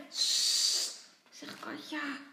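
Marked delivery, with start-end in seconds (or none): 0.1-1.1 loud, sharp shush. A narrator's reading. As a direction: hush a noisy little brother. Sister shushing her little brother.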